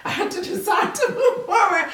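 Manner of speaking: high, laughing through the words; helpless giggly delivery. Real, not acted. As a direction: crack up while confessing. Laughing at her own confession.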